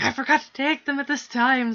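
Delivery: high, loud alarmed cry; panicked realisation. Retold or imitated. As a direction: blurt it out in sudden panic. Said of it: "I'm like".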